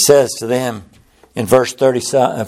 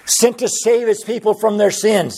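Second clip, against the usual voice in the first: ordinary talk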